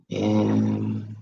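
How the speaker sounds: soft breath-led meditation guidance with long pauses; guided breathing. Shown as a demonstration.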